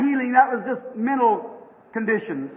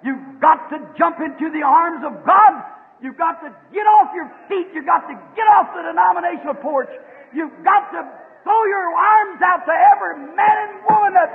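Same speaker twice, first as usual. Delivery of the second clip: raised, high preaching cry; fervent exhortation; 4 semitones higher than usual. Real, not acted. Preacher urging the congregation to jump to God, fervent high exhortation. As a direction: drive each command home to the congregation.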